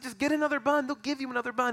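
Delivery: high-pitched, retold urging; mock urgent plea. Retelling himself urging suffering friend, mock urgent.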